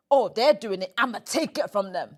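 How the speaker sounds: loud mock-envious quote; envious grabbing. Host showing guest the wrong version, loud envious mimicry. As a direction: play the resentful taker, loudly. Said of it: "Not as".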